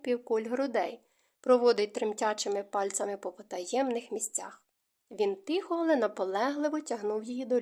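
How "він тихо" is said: plain reading voice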